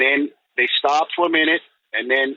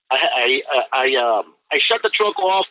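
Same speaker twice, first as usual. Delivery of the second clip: ordinary talk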